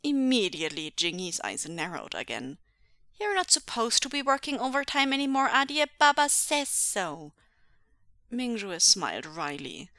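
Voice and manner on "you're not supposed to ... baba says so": put-on high, loud little boy telling off; scolding child. A narrator's reading. Son scolds father about overtime, high and insistent. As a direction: lay down Baba's rule in a child's voice.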